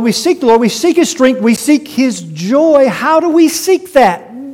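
loud, high pulpit delivery; full-force preaching. Acted. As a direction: drive the point home to the church.

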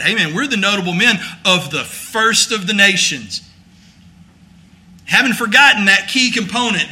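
loud and high, driving the point home hard; full-force preaching. Acted. Preacher rails against proud men, loud and driving. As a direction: preach it with rising fire at the crowd.

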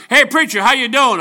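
cops calling out. Retold or imitated. Cops greeting preacher, retold.

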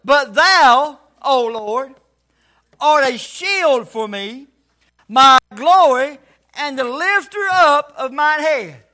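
slow, emphatic Bible recitation; scripture reading. Acted. Preacher reading a psalm to the church, solemn scripture recitation. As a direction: declaim the verse in measured, stretched phrases. Reads scripture aloud to congregation.

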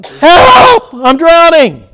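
piercingly high, full-force shout; yelling for help. Acted. Drowning man calling to a second boater, yelling. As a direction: scream it out, stretching the words. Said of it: "So I start yelling".

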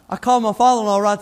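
laughing through the words, fast; can't stop chuckling as he tells it; real laughter. Real, not acted. Pastor telling the church about his son, laughing. Laughing at son's golf mimicry.

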